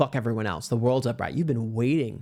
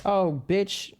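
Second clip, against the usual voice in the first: ordinary delivery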